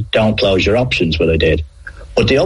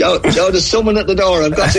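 high, joking escape line; playful fake excuse to dodge the question; mock excuse; 11 semitones higher than usual. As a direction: pretend to be called away.

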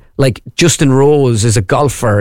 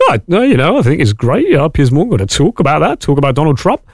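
swooping, lilting impression of a TV host; put-on presenter voice, pitch bouncing up and down; Piers Morgan. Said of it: "I'm trying to do Piers Morgan".

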